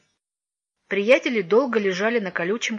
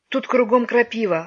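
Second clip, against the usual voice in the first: whiny complaint, raised and louder; boy whimpering in the nettles; 4 semitones higher, 5 dB louder than usual. A narrator's reading. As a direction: whine about the nettles. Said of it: "хныкал Лёшка".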